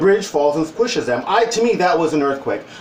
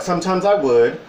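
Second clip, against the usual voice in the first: normal talking voice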